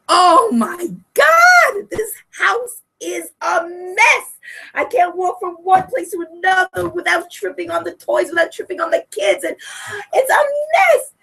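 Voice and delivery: acted-out husband griping in rising exasperation; exasperated husband. Husband to wife about the messy house, exasperated.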